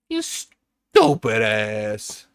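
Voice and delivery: drawn-out mock insult. Streamer scolding himself over a build mistake, slow and loud. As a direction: savour every word of the insult.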